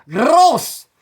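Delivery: high loud grossed-out groan; disgusted. Retold or imitated. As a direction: spit out disgust. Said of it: "I mean".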